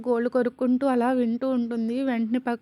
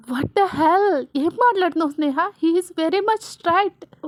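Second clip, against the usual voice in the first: louder, higher indignant protest; wild outburst; 4 semitones higher, 4 dB louder, slower than usual. Acted. Vasu defending her best friend to Sneha, wildly indignant. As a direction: react with raised-voice outrage.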